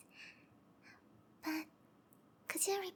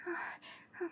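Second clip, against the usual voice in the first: usual speaking voice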